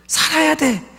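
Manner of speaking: 우는 목소리로 높게 떨리며 애원하듯; 아들을 안고 울며 살아야 한다고 외치듯; crying plea. Retold or imitated. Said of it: "막 너무 울었어요". (꿈속에서 아들을 안고 울부짖는 아빠, 높은 울음 섞인 애원)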